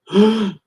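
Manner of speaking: high sharp gasp; shocked gasp. Acted. Prince reacting to the slammed door, high shocked gasp. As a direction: gasp in offended surprise. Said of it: "in a gas, like, shocked".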